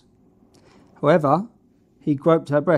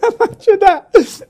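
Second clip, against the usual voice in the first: laughing; 8 dB louder, much slower than usual. Real, not acted. Laughing repeat.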